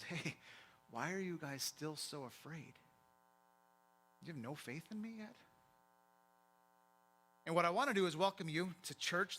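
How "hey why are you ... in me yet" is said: soft, quiet reproach; hushed questioning. Acted. Jesus asks his closest friends after the storm, hushed questioning. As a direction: ask it gently, well below speaking level.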